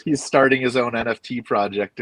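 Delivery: usual speaking voice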